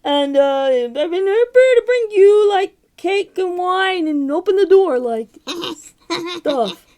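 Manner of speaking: loud, high put-on voice, breaking into a laugh; the wolf overplays the sweet girl until he cracks up; loud hammed-up disguise that ends laughing; wolf faking a girl. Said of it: "Wow, that's a good impression".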